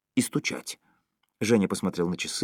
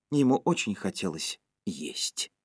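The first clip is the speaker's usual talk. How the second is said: ordinary delivery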